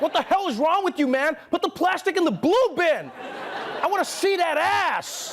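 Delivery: high, loud comic tirade about the bins; mock angry rant. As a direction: scold a bad recycler in mock outrage. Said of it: "Be like".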